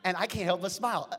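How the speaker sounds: loud, bouncing imitation of her walk; lively loud mock strut; excited. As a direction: pump it up like her joyful stride.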